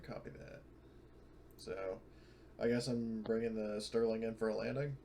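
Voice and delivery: ordinary talk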